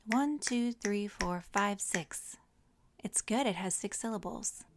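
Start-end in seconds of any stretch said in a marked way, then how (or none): none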